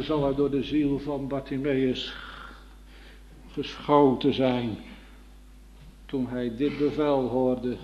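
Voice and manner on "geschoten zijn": ordinary delivery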